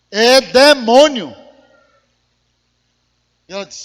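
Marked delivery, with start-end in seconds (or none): 0.1-1.4 very loud, slow proclamation; booming declaration. Retold or imitated. Pastor telling the woman what attacks her marriage, loud and slow. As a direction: boom it out, each word drawn out. Emphatic acted diagnosis to the woman.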